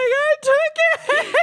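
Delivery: high, loud, slow laughing confession; cracking up as a sobbing child; laughing mock sob. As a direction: wail it out between laughs. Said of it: "I was like".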